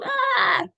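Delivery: put-on high yelp of a child losing it; sudden panicked cry; mock meltdown cry. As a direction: squeal like an overwhelmed kid. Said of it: "They just go".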